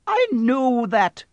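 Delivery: high, drawn-out irritated retort; irritable. A narrator's reading. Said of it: "said Professor McGonagall irritably".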